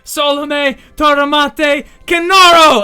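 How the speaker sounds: loud, high spell words, building; shouted incantation. Acted. Fiona opening a portal from her book, shouted incantation. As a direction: call out the spell, last word loudest.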